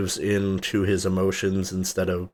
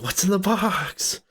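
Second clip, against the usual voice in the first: high, slow, strained mocking of the line; silly Brad Pitt impression; 12 semitones higher than usual. Mocking the actor's silly delivery to a friend, high and slow. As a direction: ham up the desperate question. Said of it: "his performance is almost silly".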